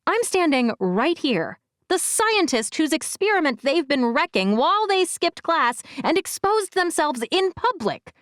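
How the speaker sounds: high, outraged protest; indignant. Acted. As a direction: huff it out, offended. Narrator indignantly objects.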